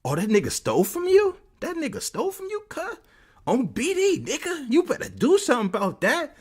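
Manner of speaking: very high, put-on goading voice; egging him on. Street guys in the dorm goading him, high imitation. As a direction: taunt him into acting, in mock falsetto. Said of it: "egging him on".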